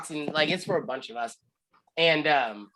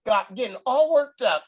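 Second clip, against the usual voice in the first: teasing Matt; 10 semitones higher, 4 dB louder than usual. Retold or imitated. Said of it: "Matt literally is like".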